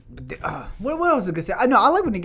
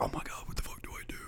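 whispered panic, quick and breathless; panicked whisper; 18 dB quieter, faster than usual. Retold or imitated. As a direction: mimic a scared kid whispering in panic. Young Batman panicking, whispered.

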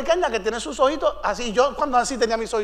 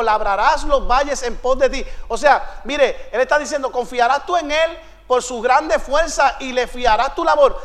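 ordinary talk, nothing marked

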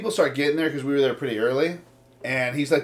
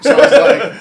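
laughing; 11 semitones higher, 14 dB louder than usual. Real, not acted. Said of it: "laughing build-up".

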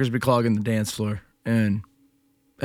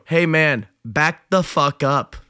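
raised, firm telling-off with drawn-out last words; higher and louder, slowing on the order; retold confrontation; 4 semitones higher, 4 dB louder, slower than usual. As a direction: tell him off over the music. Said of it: "had to, like, lean over and go".

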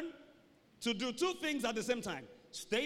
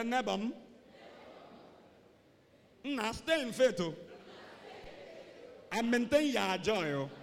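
Igbo accent. Shown as a demonstration. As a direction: say it like an Igbo speaker. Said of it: "If you're an Igbo person".

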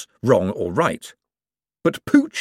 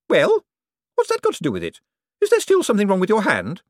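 shrill demands pitched way up; Poochie's voice; 11 semitones higher than usual. Acted. To Cosmo, a greedy high-pitched rich woman.

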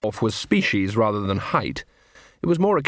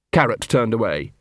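plain reading voice

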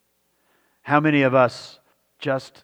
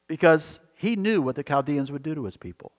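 usual speaking voice

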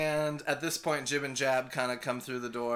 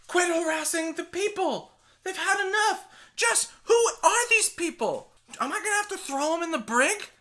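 much higher, louder indignant official voice; shrill scolding official; 5 dB louder than usual. Acted. Koba scolding the rowdy pirates, high and loud. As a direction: scold them like a fussy captain.

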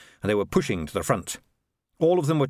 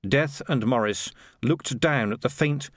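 ordinary delivery, nothing marked

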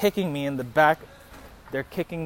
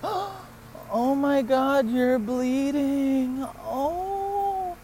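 very high, drawn-out mock cooing; softened concern; 10 semitones higher, much slower than usual. Girl noticing his bleeding face, mock tender concern. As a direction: imitate her slow, high-pitched sympathy. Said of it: "She softens up and she says".

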